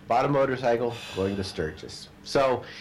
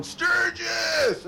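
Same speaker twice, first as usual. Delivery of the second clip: gleeful shout. Retold or imitated. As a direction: shout it out like a happy biker. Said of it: "he's just going".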